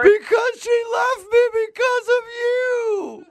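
slow, high wailing yell; sob-shout the blame; crying shout. Acted. Fake victim wails at the artist.

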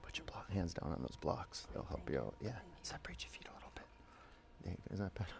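hushed aside to a student; whispering. Real, not acted. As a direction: whisper it close by. Quiet aside to one student.

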